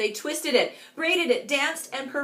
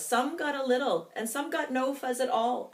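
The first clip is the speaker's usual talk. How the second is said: ordinary delivery, nothing marked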